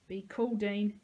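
usual speaking voice

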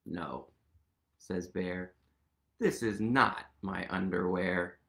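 low rumbling polar bear. Acted. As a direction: drop it low and heavy like a big bear.

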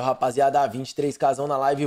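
ordinary talk, nothing marked

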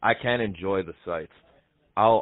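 ordinary delivery

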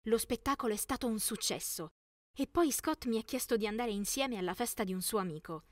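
plain reading voice

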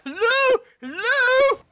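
high, loud, drawn-out dopey call; clueless mocking hello. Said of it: "Going around like a fucking".

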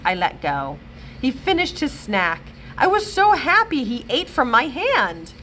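ordinary delivery, nothing marked

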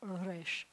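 "resh" with a softly rolled r; quiet "resh" opening on a gentle trill; gently trilled R. Shown as a demonstration. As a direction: roll the r of "resh" lightly, almost Spanish. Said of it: "you had to make it a gently trilled".

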